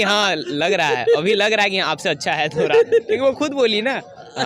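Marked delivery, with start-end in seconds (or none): none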